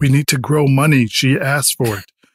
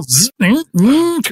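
high doorbell ding; 11 semitones higher, much slower than usual. Acted. Doorbell sound imitated.

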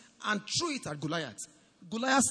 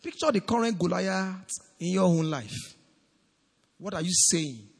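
ordinary talk, nothing marked

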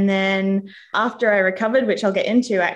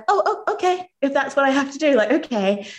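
resigned acceptance; 7 semitones higher than usual. Retold or imitated. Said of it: "And I was like".

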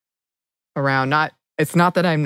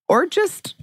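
slow question; much slower than usual. Real, not acted. Asks listeners, slow.

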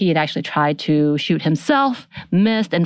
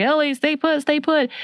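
high-pitched mock panic; freaking out; 10 semitones higher than usual. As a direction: imitate an urgent, panicked order. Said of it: "And he's freaking out".

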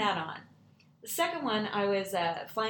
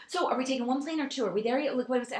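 put-on higher, hurried child questions; imitating an impatient child; eager little girl; 5 semitones higher, faster than usual. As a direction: fire off questions like an excited kid. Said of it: "she's talking and she's like".

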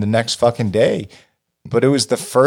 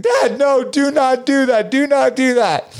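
much higher, urgent begging no; desperate pleading; 14 semitones higher than usual. Retold or imitated. Tripping son begging his dad not to move mom, high and desperate. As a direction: plead like a scared kid, voice way up.

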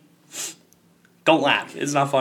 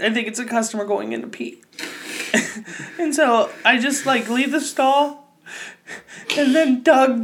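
ordinary talk, nothing marked

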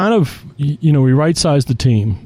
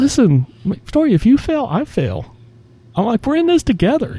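mock reassuring boss; 13 semitones higher than usual.

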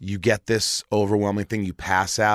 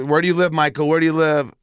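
raised, urgent questioning nurse; 6 semitones higher, 5 dB louder, faster than usual. Retold or imitated. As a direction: call out to a fading patient. Nurse asking dazed patient questions.

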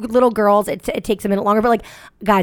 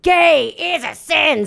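mock conservative parent; 6 semitones higher, much slower than usual. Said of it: "like they were like".

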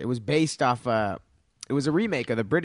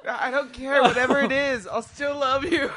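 mock tender declaration; 10 semitones higher than usual.

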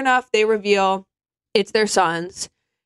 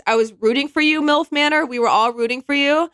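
Tyra Banks quote; 5 semitones higher than usual. Retold or imitated. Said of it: "to quote Tyra Banks".

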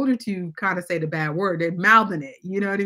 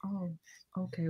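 hushed; 14 dB quieter than usual. Retold or imitated. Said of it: "And I'm like".

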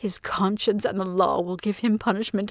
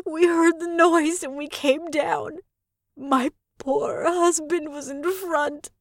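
slow, higher, half-sobbing account; tearful testimony; 6 semitones higher, slower than usual. A narrator's reading. Lady tells the constable of the murder, slow and tearful. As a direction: tell it slowly, voice catching with tears.

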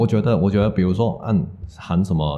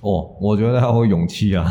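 ordinary talk

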